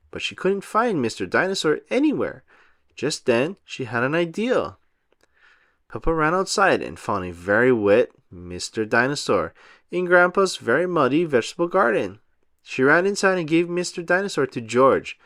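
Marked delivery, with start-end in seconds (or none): none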